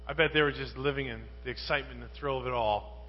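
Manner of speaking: usual speaking voice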